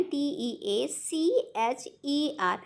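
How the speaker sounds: slow, letter-by-letter spelling of टीचर; spelled out. Shown as a demonstration. Teacher spells a word for students, slowly letter by letter. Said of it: "जैसे कि टीचर हम यहाँ पास लिख रहे हैं".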